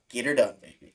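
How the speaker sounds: a hint of a Southern drawl, get her done; borrowing a folksy American catchphrase, lightly voiced; NASCAR drawl. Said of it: "to borrow an American expression".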